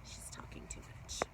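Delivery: breathy unvoiced aside; whisper. Shown as a demonstration. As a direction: lean in and whisper it. Repeated as a whisper.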